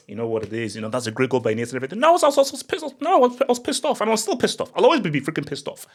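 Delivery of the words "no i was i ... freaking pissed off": still pissed off. Real, not acted. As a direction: rant it out, pitch way up.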